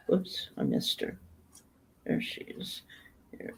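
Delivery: whispered aside to herself; whispering. Real, not acted. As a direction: whisper it under your breath. Muttering to herself at screen.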